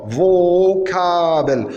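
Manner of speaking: "vocabel" slowly, with v and stretched vowels; demonstrated pronunciation. Shown as a demonstration. Teacher showing Fao said as V in a foreign word, demonstrated pronunciation. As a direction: draw out "vocabel" syllable by syllable. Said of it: "il s'est prononcé V".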